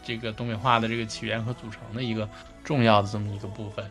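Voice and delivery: usual speaking voice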